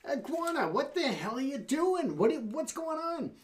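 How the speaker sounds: high, incredulous critter voice; startled alligator. Acted. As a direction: pull the wet iguana out and demand answers.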